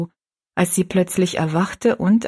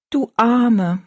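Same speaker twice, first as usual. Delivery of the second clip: pitying; 5 semitones higher, 4 dB louder than usual. Acted. Child comforting the overworked maid, tender and higher. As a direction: feel sorry for her gently. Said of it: "meinte Rosalie mitleidig".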